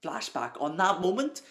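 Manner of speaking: high, loud incredulous question; loud disbelief. Real, not acted. Reader protesting the cut to a flashback, loud disbelief. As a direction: exclaim it in real exasperated disbelief.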